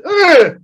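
high loud imitated grunt; imitated ugh grunt. The guy next to him making noises, a loud high grunt. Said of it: "this noise".

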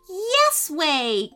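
put-on high boyish cry back; excited cry. A narrator's reading. Calvin answers his brother on seeing Mickey, excited cry. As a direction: answer with high, eager delight. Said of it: "cried Calvin".

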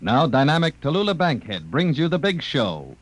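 ordinary talk, nothing marked

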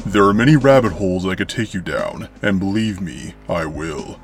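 ordinary delivery, nothing marked

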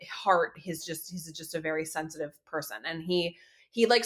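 usual speaking voice

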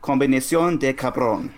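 crisp native-like Spanish accent; Spanish pronunciation. Shown as a demonstration. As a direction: say it like a fluent Spanish speaker. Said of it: "go ahead".

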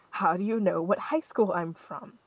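ordinary talk

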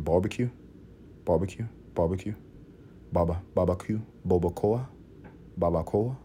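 barbecue murmured softly, drifting to babacoa; sounding it out. Shown as a demonstration. Quietly sounding out barbecue to show its Native roots. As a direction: repeat barbecue softly until it turns into babacoa. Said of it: "say it enough, you start to realize".

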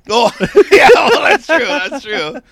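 real laughter while conceding the point; laughing. Real, not acted. Caught out by friend's joke.

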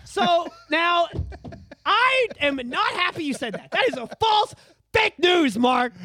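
loud, high fake outrage; mock indignant shout. Real, not acted.

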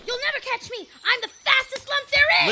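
gleeful shouting taunt; excited taunt. Pretend. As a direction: tease while running off. Child runs off teasing dad.

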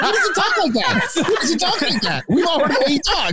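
high-pitched, laughing disbelief; mock protest. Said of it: "I'm like".

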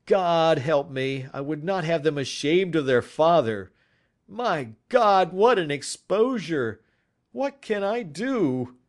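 put-on the prisoner despairing over his children's shame. A narrator's reading. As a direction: plead with rising despair.

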